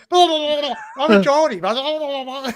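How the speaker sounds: loud mock Geordie babble; exaggerated blah-blah impersonation; mock imitation. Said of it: "He says".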